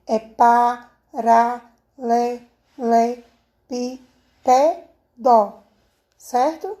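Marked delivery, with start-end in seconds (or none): 0.2-5.5 PARALELEPÍPEDO split pa-ra-le-le-pí-pe-do, very slowly; syllable by syllable. Shown as a demonstration. Teacher showing pupils how to separate the word, PARALELEPÍPEDO syllable by syllable. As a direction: break PARALELEPÍPEDO into seven spaced syllables, pausing after each. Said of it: "Como é a separação de paralelepípedo?".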